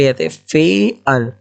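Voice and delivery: फेयर stretched out slowly, syllable by syllable; drawn-out फेयर, each sound separated; careful syllable-by-syllable fair. Shown as a demonstration. Teacher showing students how to say फेयर, slow and drawn out. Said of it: "जैसे लिखा है यहाँ पे फेयर".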